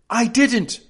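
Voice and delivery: high, quick, blurted denial; hurried denial. A narrator's reading. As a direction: blurt it out in a hurry. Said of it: "in a great hurry".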